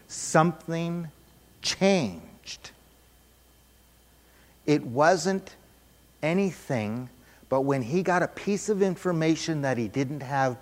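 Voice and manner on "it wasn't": usual speaking voice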